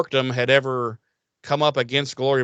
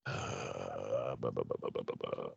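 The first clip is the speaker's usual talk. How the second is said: trailing stammer; 21 dB quieter than usual. Real, not acted. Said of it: "I don't remember how".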